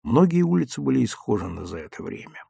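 ordinary delivery

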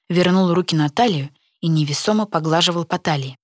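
usual speaking voice

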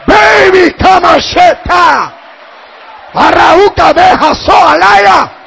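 high, very loud Pentecostal shouting; full-force preaching. Real, not acted. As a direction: proclaim it at the top of your voice.

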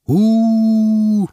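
loud, high drawn-out howl; crowd howl. A narrator's reading. Crowd howls at the harsh sentence. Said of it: "взвыла толпа".

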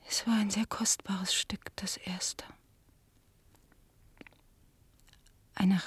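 breathy whisper, ashamed of the secret; whispered confession. Acted. Daughter confessing the sold etching to the guest, whispered. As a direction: whisper it so no one else hears.

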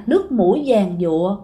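ordinary talk

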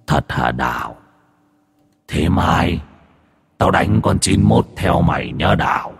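low echoing ghost voice; an eager ghost, deep and hollow; demonic voice. Acted. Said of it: "bật cái giọng ma quỷ lên xem nha".

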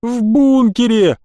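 high, drawn-out moaned call; moaning. A narrator's reading. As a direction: moan it up from below. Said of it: "простонал он".

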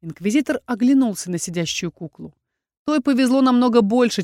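usual speaking voice

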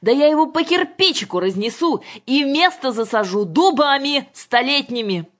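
loud, high-pitched furious threats; thunderous outburst. A narrator's reading. Father threatens to blow up the shed, thunderous anger. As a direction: explode like thunder. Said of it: "грянул гром".

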